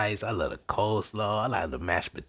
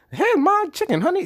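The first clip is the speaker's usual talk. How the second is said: much higher, louder Southern lady; Louisiana lady; 8 dB louder than usual. Retold or imitated. Mocking the Popeyes spokeswoman with a high, loud Southern squeal. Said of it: "that Louisiana lady".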